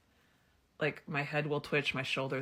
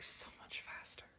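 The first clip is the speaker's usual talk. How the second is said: whisper; 18 dB quieter than usual. Real, not acted. Drops to a whisper on the point.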